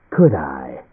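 low, mocking question. A narrator's reading. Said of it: "Its tone was mocking".

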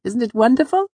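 put-on very high, loud girlish delight; high-pitched, gleeful boast; proud Auri. A narrator's reading. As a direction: exclaim it proudly in a high little voice. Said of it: "she said proudly".

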